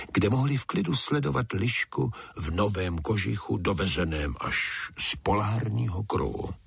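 ordinary delivery

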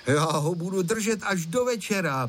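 put-on storybook boy showing his resolve; determined declaration. A narrator's reading. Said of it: "prohlásil Honzík a na tváři mu bylo vidět".

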